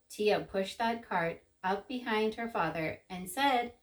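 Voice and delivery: plain reading voice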